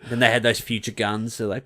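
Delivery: ordinary talk, nothing marked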